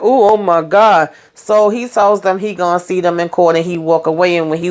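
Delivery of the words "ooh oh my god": hammed-up, pained "ooh, my God"; mock disgusted groan. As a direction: groan it like he's repelled. Zach reacts while being served.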